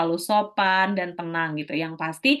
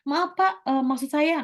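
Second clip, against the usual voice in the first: much higher, eager cut-in; interrupting candidate; 7 semitones higher, slower than usual. Retold or imitated. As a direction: jump in over the interviewer, pitched up high. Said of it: "Nah, jangan seperti itu".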